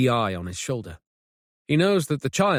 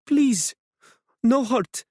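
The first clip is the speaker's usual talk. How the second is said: high, broken, near-whispered begging; desperate broken plea; 12 semitones higher, slower than usual. A narrator's reading. Hiding woman begging strangers for mercy, desperate plea. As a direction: plead like a terrified woman in hiding. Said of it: "desperate and broken, and barely a whisper".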